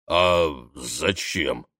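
deep slow forest spirit; slow low leshy voice. Acted.

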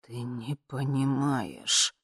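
low, slow, quiet seething; soft, drawn-out, barely contained; shaking with tension. A narrator's reading. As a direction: hold it down while trembling inside. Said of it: "А буквально трясло".

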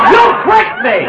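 high indignant shout; outraged shout. Acted. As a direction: yell at being tricked. Tricked into swallowing medicine.

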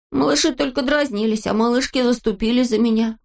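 put-on high-pitched childlike confession; Neznaika's boyish voice. A narrator's reading. Boy tells girls he changed his mind, high boyish voice. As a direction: admit it in a high little voice.

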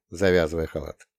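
usual speaking voice

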